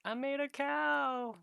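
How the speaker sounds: high, slow, drawn-out holler; loud comic triumph, words stretched; mock exultant cry. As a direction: proclaim it in a hammed-up high voice. Acts character exulting over calf birth.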